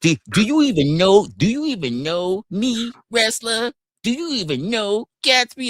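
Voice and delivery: high, slow sneering mock of a chatter; high mock voice of a smug troll in chat. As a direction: ape the smug troll who asks. Mocking a hostile chatter.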